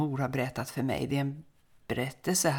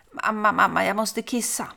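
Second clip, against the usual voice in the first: put-on child's plea; 7 semitones higher, 4 dB louder than usual. Little son asks mother.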